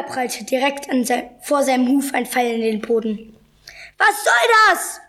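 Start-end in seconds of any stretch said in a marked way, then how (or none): 4.0-5.0 high cry of protest; indignant shout. A narrator's reading. Zebra with an arrow at his hoof, indignant high shout. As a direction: protest with pitch shooting up.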